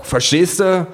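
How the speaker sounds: hoch nachgefragt; betrunkene Nachfrage. Acted. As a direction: eindringlich und hoch nachhaken. Betrunkene Nachfrage nach den Geräuschen. (hohe, angetrunkene Nachfrage)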